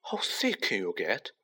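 hushed, understated delight; ecstatic whisper. A narrator's reading. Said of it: "Ron whispered ecstatically".

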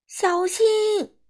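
high, drawn-out warning cry; acted high-pitched yell; loud shout. A narrator's reading. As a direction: call out a sharp rise in alarm. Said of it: "米歇尔大声喊".

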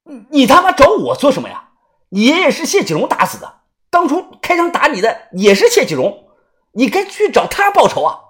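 high, loud, rapid protest; panicked loud shout. Acted. Pale man shouting that someone else deserves the revenge, loud and panicked. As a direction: shout it pitched right up, stammering into it. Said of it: "大声地喊道".

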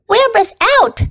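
high, excited announcement; excited animal news. A narrator's reading. Horses pass on news of the escape, excited. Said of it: "heard the goose hollering".

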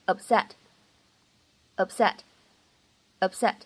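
"upset" with the p held and not released; "upset" 不完全爆破; unreleased p. Shown as a demonstration. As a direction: close the lips on the p in "upset" without a burst. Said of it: "不完全爆破之后呢，我们会读成是".

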